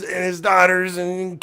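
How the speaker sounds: ordinary delivery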